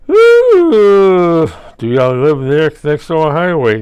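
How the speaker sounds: loud, high curious voice; nosy questioner. Retold or imitated.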